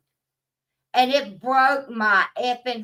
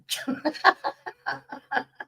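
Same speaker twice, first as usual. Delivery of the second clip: laughing; 4 semitones higher, 4 dB quieter than usual. Real, not acted. Laughing at her cat story.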